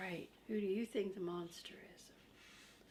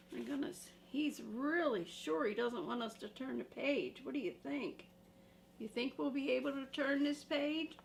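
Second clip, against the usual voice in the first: ordinary delivery, nothing marked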